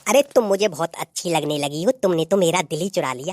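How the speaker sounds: usual speaking voice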